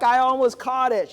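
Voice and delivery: insist, still thrilled; insistent excitement. Retold or imitated. Repeating it to his wife, thrilled and insistent.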